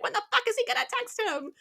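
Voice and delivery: high-pitched retold exasperation; impatient. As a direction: act out fretting over the wait. Retells waiting impatiently for text.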